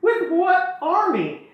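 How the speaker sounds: high hammed-up scoff at his bluff; mocking queen. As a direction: sneer it out in a drawn-out falsetto. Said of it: "she's like".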